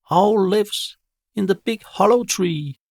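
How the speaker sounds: high, loud cooing pigeon voice. Acted. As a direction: speak like a chirpy pigeon. Pigeon answers Jazz.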